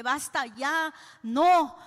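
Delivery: loud, high cry to stop him; desperate shout of basta; retold shout. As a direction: yell at the father to stop. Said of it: "empecé a gritar".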